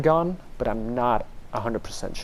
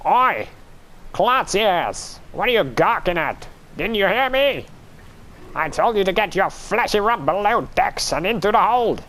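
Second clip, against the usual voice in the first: shouting pirate captain; 5 semitones higher than usual. Acted. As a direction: bark orders at a gawking deckhand. Captain scolds the builder on deck.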